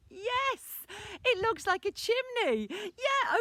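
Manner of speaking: put-on high, loud, delighted child; excited discovery. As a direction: squeal it out as you spot it. Boy discovers the apple's chimney.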